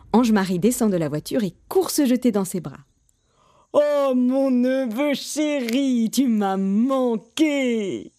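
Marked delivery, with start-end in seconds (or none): 3.7-8.1 slow, gushing greeting; doting aunt. A narrator's reading. Aunt hugging the nephew she missed, slow gushing. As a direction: gush over a beloved nephew, drawing out each word.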